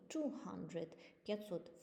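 ordinary talk

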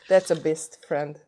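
ordinary talk, nothing marked

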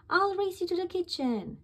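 high, playful challenge; exclaiming. A narrator's reading. Dad luring Bingo to the kitchen, playful high exclaim. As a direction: dare the child to race. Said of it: "Dad exclaims".

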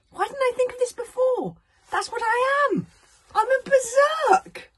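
very high, loud, slowing proclamation; wild revelation. A narrator's reading. Fishlegs proclaims to Hiccup he is a berserk. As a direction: announce it like a discovery.

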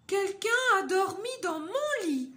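voix de maman ours plus haute et plus forte; la maman ours s'étonne, voix haute; maman ours aiguë. Acted. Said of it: "Et Maman Ours dit". (Maman Ours découvre le chocolat goûté, voix aiguë)

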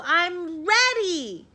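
high, loud, drawn-out reply; yell. A narrator's reading. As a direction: yell back as an eager little frog. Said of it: "yelled Froggy".

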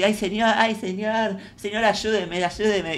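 algo más aguda, suplicante de mentira; súplica teatral de ingenua; ingenua pidiendo ayuda. Retold or imitated. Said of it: "Y viene un señor". (ruego fingido al señor)

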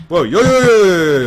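high, loud panicky yelps; real panic, rising high and loud; panicked oj oj. Real, not acted. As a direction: yelp in alarm as things go wrong. Player panics as game goes wrong.

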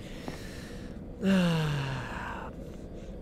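long, soft exhale; long sigh. Real, not acted. Player uneasy in horror game, long soft sigh. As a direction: let out a slow soft sigh.

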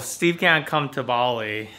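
ordinary talk, nothing marked

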